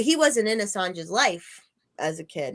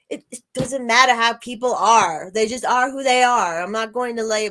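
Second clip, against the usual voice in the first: louder, higher attempt at an Australian accent; imitated Australian accent; 4 semitones higher, 5 dB louder than usual. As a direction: quote him emphatically with an Australian twang. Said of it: "you know, he's Australian".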